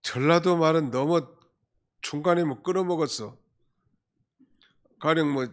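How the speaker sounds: ordinary talk, nothing marked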